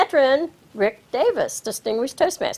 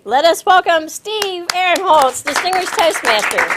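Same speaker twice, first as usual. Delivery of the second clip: emcee's big welcome; 6 semitones higher, 5 dB louder than usual. Real, not acted. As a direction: roll out the name like a ring announcer. Emcee introducing topic master.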